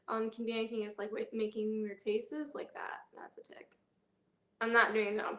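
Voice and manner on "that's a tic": whispered aside. Real, not acted. Whispered asides around a tic.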